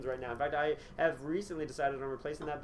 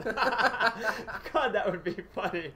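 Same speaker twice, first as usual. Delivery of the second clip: usual speaking voice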